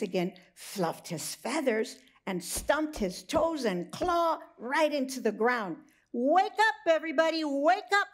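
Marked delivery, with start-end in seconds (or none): 6.1-8.1 high, loud rooster call; wake-up call. Acted. Rooster calling everybody awake, high and loud. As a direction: rouse the whole barn. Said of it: "Marvin said".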